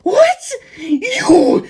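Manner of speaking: extremely high, loud squeal; shrieking monster shock; shocked zombie. Acted. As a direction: squeal in disbelief. Antonio shocked.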